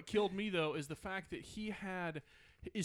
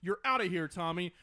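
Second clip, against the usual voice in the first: usual speaking voice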